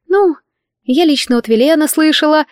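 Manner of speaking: high, small maid voice; squeaked admission. A narrator's reading. Maid cornered about who spread the rumour, high squeak. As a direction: squeak it out reluctantly. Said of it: "пропищала Камила".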